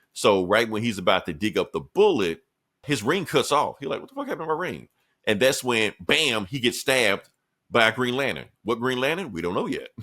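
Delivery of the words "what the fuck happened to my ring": shocked outburst. Retold or imitated. Hero panics at lost ring.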